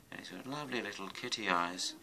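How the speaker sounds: ordinary delivery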